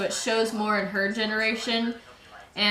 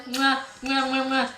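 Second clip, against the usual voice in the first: between kisses; 3 semitones higher than usual. Real, not acted. Blowing kisses to brother.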